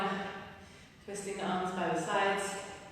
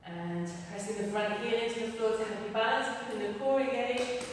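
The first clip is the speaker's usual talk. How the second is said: energetic workout coaching; 3 semitones higher than usual. Acted. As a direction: pump the class up through each move. Leads a workout with cues.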